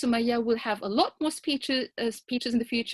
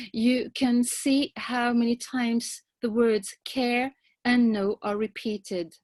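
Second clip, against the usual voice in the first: ordinary talk, nothing marked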